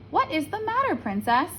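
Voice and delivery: high, squeaky little frog. A narrator's reading. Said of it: "The frog asked again".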